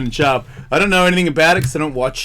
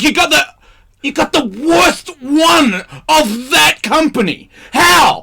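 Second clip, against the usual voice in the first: high, loud teasing yell at a friend; mock outraged shout; 10 semitones higher, 4 dB louder, slower than usual. Mocking a friend's shoes with a high outraged shout. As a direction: yell it in disbelief, mocking his taste. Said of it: "'Cause I'm like, I'm like".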